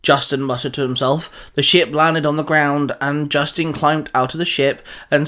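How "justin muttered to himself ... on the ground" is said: ordinary delivery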